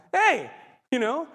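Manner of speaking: retold high, loud protest; mock an affronted shout; indignant call. Son objecting as dad throws stuff at him, indignant call.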